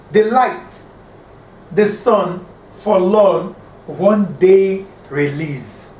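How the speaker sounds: "The light, the sun, forlorn, one day release" in separate two-beat chunks, pausing after each; broken into syllable pairs. Shown as a demonstration. Teacher scanning a line for students, "The light, the sun, forlorn, one day release" in paused pairs. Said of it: "I've broken every word here into two".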